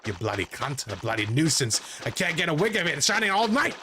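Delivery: exasperated broad Australian rant; Australian dolphin. Acted. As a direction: build an Australian-accented complaint. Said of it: "is this dolphin Australian?".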